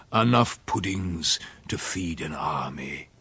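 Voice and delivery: usual speaking voice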